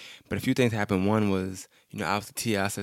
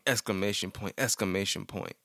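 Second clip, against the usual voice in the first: normal talking voice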